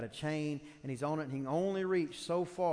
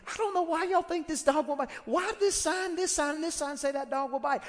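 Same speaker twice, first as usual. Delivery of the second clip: much higher, louder foolish whine; mocking stubborn fool; 12 semitones higher, 5 dB louder than usual. Preacher mimics a believer shrugging off the warnings, high mock whine. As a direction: play the fool who ignores every warning.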